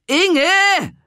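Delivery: loud, high call across the beach. Acted. Renate calls Inge across the beach, loud and high. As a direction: shout her name from a distance.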